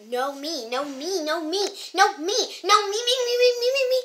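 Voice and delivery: building from normal to high, loud chant; escalating squabble. Acted. Girls squabbling over who leaves, escalating to shrieky insistence.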